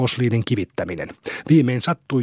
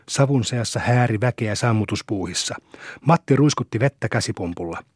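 ordinary delivery

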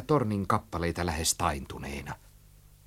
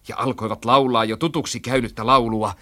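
usual speaking voice

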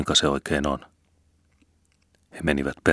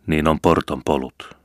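plain reading voice

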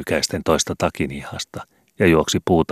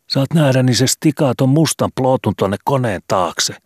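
put-on much higher and louder, eager boy; excited boy; 9 semitones higher, 4 dB louder than usual. Skobe tells Vili what the photographer will do, excitedly. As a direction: blurt it out like a thrilled kid.

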